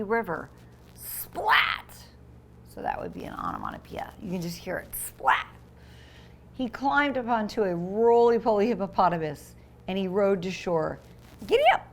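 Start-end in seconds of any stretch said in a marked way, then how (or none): 0.9-1.9 whispered splat. Acted. Reading a splat sound. 11.5-11.9 high, loud cowboy cheer; excited giddy up. Acted. Boy on a hippo calls out, high and loud.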